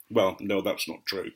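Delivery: usual speaking voice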